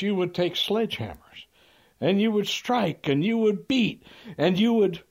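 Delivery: hammed-up outraged lawyer at full volume; imitated near shout. Lawyer grilling the repairman before the jury, theatrical high shout. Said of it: "he's almost shouting now".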